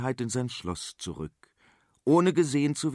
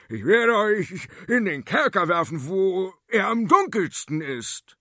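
higher, louder royal threat; furious threat; 8 semitones higher, 5 dB louder than usual. Acted. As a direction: hurl the threat at them. King threatens the farmers. (wütende Drohung)

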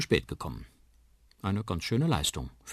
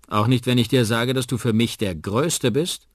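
loud, higher, playful coaxing; 5 semitones higher, 8 dB louder than usual. Acted. Uncle flatters Liam to cheer him up, coaxing loudly. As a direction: cheer a downcast child along. Uncle coaxes a sad boy.

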